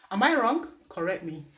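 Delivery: ordinary delivery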